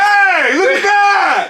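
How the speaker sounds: high, loud holler; retold excited shout. Man hollering for people to look, high shout. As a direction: call it out like the man in the story.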